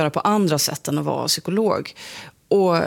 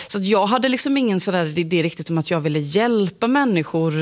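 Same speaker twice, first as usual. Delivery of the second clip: ordinary talk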